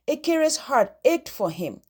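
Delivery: plain reading voice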